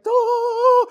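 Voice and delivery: high, loud mock fanfare as the curtain opens; fanfare sound. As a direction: sing out a bright mock trumpet note. Curtain opening to first class.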